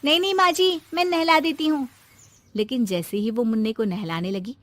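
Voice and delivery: ordinary delivery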